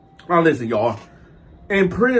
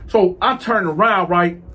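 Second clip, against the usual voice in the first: ordinary delivery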